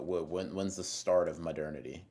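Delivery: usual speaking voice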